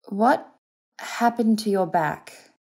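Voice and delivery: high, drawn-out urgent question; demanding, barely steady. A narrator's reading. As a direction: demand it, struggling to keep control. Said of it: "finding it difficult to keep her voice steady".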